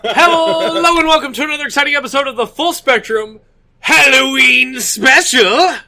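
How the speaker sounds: high, loud announcer welcome; over-the-top Halloween special announcer; hammy show intro. Pretend. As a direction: belt out a big exciting episode opener. Hosts doing the show's mock intro.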